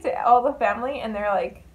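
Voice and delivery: ordinary talk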